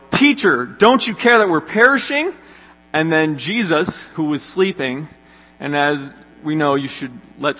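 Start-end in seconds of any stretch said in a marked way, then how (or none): 0.1-2.3 high, loud mock-desperate cry; desperate plea. Disciples waking sleeping Jesus in the storm, desperate high plea. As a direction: wake the sleeping teacher in alarm. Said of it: "and then they go".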